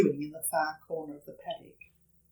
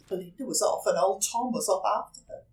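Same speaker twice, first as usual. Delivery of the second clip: ordinary delivery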